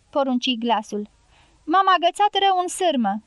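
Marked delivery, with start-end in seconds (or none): 1.7-3.2 high, louder cry; calling out. A narrator's reading. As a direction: shout while caught in the wire. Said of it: "strigă Andres". (strigăt prins în sârmă)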